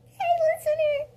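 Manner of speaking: very high, loud squeak; botched high-pitched Bruce voice; squeaky high voice. Acted. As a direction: squeal it like a little kid. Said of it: "I'm sorry. What was that word?".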